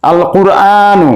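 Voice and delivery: slow "আল কুরআনু" with a clear L and long final aa; lam pronounced. Shown as a demonstration. Teacher showing students the lam sounded in a slow "আল কুরআনু". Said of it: "আলিফ লামের লাম উচ্চারণ হচ্ছে".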